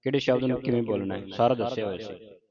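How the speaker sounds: ordinary delivery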